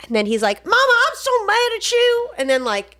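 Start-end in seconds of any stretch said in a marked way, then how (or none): 0.7-2.3 high, hammed-up Southern whine. Son angry at his mother, high mock-Southern wail. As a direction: complain loudly in a poor Southern impression. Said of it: "sorry, my [name] impression".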